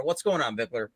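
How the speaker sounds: ordinary talk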